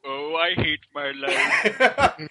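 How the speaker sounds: slow weary groan of despair; mock despair. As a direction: drag it out like you're miserable. Mock complaint while mopping.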